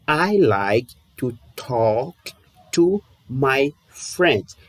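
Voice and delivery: "I like to talk / to my friends" split in two, very slow; very slow drill. Shown as a demonstration. Teacher drills the sentence very slowly a third time. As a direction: stretch every word out so students can follow. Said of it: "De novo".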